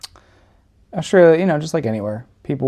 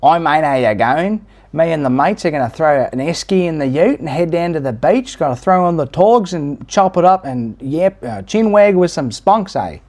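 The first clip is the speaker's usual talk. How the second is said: higher, louder broad Australian accent; 6 semitones higher, 4 dB louder than usual. Shown as a demonstration. Redoing the attempt for viewers, hammed-up Australian accent. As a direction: lay the Australian accent and slang on thick. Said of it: "Let me redo that".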